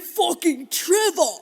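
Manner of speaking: loud, high, drawn-out curse; mock jealous outburst. Imitating the jealous ex cursing his rival, mock outburst. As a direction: spit the name out slowly and loudly.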